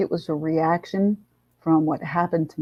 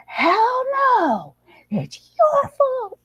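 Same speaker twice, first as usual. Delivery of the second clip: high, piercing scream, dragged out slow; much slower than usual. Retold or imitated. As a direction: scream the blame at her. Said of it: "I went out there screaming".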